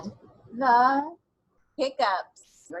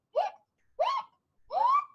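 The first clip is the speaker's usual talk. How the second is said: hiccups. Pretend. Imitating skeleton's hiccups.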